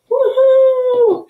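high, loud woohoo; a big happy cheer for the class; excited cheer. Real, not acted. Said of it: "I'm so excited".

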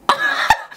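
short burst of breathless laughter; quick voiceless cackle; amused laugh. Real, not acted. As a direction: laugh it out helplessly. Laughs at shaking lips.

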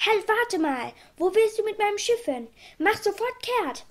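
high-pitched, insistent calling out; shouting after someone. A narrator's reading. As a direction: order a thief back from afar. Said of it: "schrie er". (mit hoher Stimme hinterherrufen)

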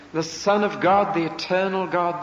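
ordinary talk, nothing marked

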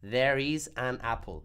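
slow, each word of There is an apple separate; said slowly. Shown as a demonstration. Profesora muestra la frase dicha despacio, palabra por palabra. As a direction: spell it out slowly and clearly. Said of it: "si lo dice despacio".

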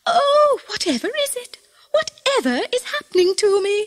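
high, flustered wail; bewildered cry. A narrator's reading. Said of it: "cried Missus Pitterpatter".

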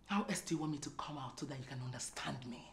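fast, very soft and unvoiced; seething whisper. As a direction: whisper it quickly with contained anger. Wife seethes before exploding.

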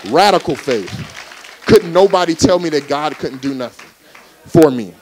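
fired-up sermon climax; preaching at full force. Acted. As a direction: preach it hard and build. Preaching builds to shout.

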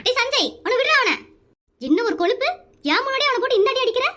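high-pitched parental scolding; alarmed scolding. Acted. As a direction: break up the fight sharply. Mother breaks up children's fight.

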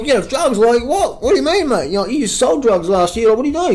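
high, sneering doubter voice; mocking critics. Critics doubting him quitting drugs, mock sneering. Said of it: "people are like".

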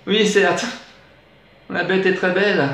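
high, feeble-hearted reply; mock-pitiful assent; pitiful agreement. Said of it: "murmurai-je piteusement".

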